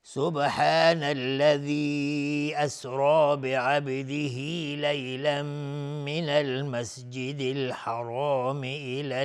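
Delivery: slow, drawn-out tajweed chant; Quran recitation. Acted. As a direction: recite the verse slowly, melodic and measured. (تلاوت آرام و کشیده قرآن)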